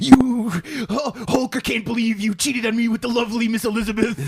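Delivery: high, loud wounded wrestler rant; shrill sobbing outrage. Retold or imitated. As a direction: howl the betrayal like Macho Man. Macho Man voice, outraged.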